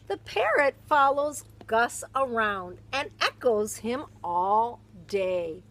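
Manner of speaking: lively, expressive picture-book read-aloud; animated storybook narration; reading to children. Acted. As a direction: read it like storytime for a little one.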